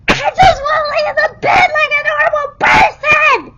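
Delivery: high, loud sobbing yell; a tearful outburst from a hospital bed; frustrated crying shout. Retold or imitated. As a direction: wail it out in pure frustration. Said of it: "crying my eyes out".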